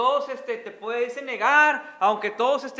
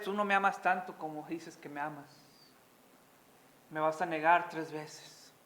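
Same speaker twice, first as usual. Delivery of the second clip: tender rebuke; 6 semitones lower, 6 dB quieter than usual. Acted. Paraphrasing Jesus to Peter.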